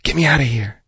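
the snake begs again in its put-on voice; viper voice. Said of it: "That's my viper voice".

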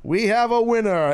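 loud high game show announcer. Real, not acted. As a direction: announce the winner big. Announcing the winner again.

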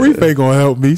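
mock-scandalized old lady shutting him down; imitating grandma. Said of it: "She like".

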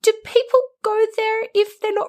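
high, baffled question; incredulous quote. Retold or imitated. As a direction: ask it totally baffled. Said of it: "I was just like".

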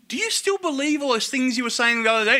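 a hint of a concerned nurse; nurse's question. Narrator voices the nurse.

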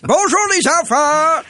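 high, slow cartoonish greeting; sing-song kids' show host; funny voice. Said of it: "prenez une voix amusante".

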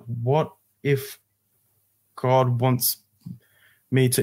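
halting, with long gaps between phrases; slowing realisation. Retold or imitated. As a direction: let it dawn on you phrase by phrase. The repeated words begin to click.

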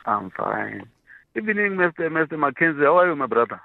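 ordinary delivery

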